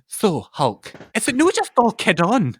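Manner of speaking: a hint of a chatty host asking a cheeky question; Lorraine Kelly. TV host asking Hulk Hogan if it's fake, lightly voiced. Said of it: "Lorraine just goes".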